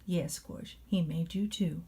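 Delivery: ordinary delivery, nothing marked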